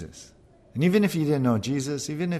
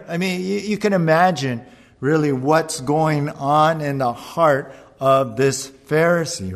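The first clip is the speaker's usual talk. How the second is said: usual speaking voice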